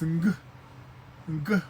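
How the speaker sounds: ng held as a nasal hum at the back; teaching the tailed N as ng; ng sound. Shown as a demonstration. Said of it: "with the N with the tail, that's ng".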